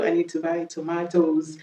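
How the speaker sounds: British "tomatoes", to-MAH-toes; British accent. Shown as a demonstration. Teacher repeating the plural, British accent. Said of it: "on va faire l'accent british".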